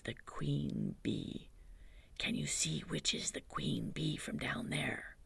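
lightly voiced Pooh, hushed and thoughtful; Pooh's hushed voice. Pooh explaining honey plan to Christopher Robin, hushed.

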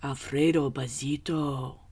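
ordinary delivery

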